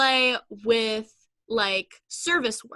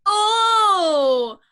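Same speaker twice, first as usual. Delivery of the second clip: loud, drawn-out sarcastic apology; John Mulaney; 6 semitones higher, 6 dB louder, much slower than usual. Retold or imitated. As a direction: stretch the sorry into a shout. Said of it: "To quote, uh, fucking John Mulaney".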